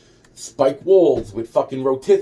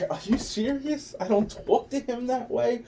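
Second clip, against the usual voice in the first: much higher, mocking echo of his words; mock incredulous; 11 semitones higher, 5 dB quieter than usual. Repeating the blocking guy's words back to mock him, high and incredulous.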